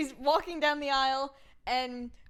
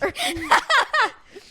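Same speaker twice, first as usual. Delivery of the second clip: big high burst of laughter; loud laugh; 12 semitones higher, 12 dB louder than usual. Real, not acted. Laughing at her own quote.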